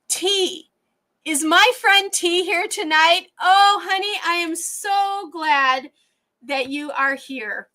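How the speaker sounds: high, emotional greeting, drawn out on "so glad"; emotional joy. Real, not acted. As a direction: greet a missed friend, overcome with gladness. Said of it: "I'm gonna get emotional".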